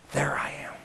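breathy whispered answer from God; God whispering back. Acted. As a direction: hush it down to a whisper.

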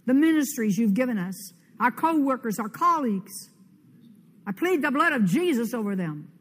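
bold, rising warfare prayer; fervent spoken prayer. Acted. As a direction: command the demons out with every line. Declares prayer against demons aloud.

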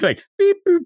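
high electronic beep boop; robot beeps. Retold or imitated. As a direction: beep it out like a machine. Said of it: "this might go off the rails".

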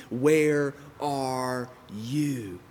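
very slow, each word stretched out; drawn-out plea. Acted. Believer asking where God has gone, slow drawn-out plea. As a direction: plead slowly, stretching every word.

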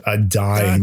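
ordinary talk

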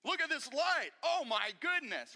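loud, high bragging call to everybody; hammed-up show-off calling for attention; mock show-off. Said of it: "it's like being like".

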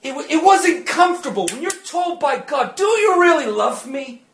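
loud, rising preacher's climax, often shouted; preaching at full force. Acted. Preacher testifying to congregation about deliverance, loud and fervent. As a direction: drive the testimony home at full volume.

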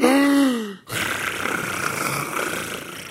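a high, swooning gasp; mock swoon of a wife; delighted gasp. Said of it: "What woman wouldn't respond with".

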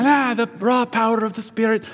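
high-pitched mock faith-healer proclamation; Benny Hinn impression. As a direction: invoke the power like a TV healer, pitched well up. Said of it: "Benny Hinn".

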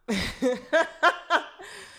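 high, loud burst of laughter; big high-pitched laugh. Real, not acted. Laughs at own bad accent.

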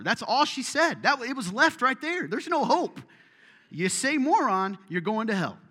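loud high ominous declaration; preacher hamming up the classmate's doom verdict, loud; mock dramatic alarm. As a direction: proclaim doom theatrically. Dramatic retelling of warning.

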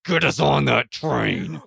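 slow, loud in-character demand; forceful demand. Acted. Player grabs the old man by the shirt, slow forceful demand. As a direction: grab him and order it, drawn out. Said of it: "by the shirt and I go".

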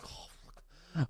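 breathy unvoiced mutter of dread; whispered groan. Reading customer emails, a whispered groan of dread. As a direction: sigh it out under the breath. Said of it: "I'm like".

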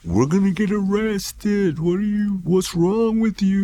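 high, drawn-out imitation of a woman; worried girl. Girl in the car fearing arrest, worried. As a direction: voice her slow, high-pitched alarm. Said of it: "And she's like".

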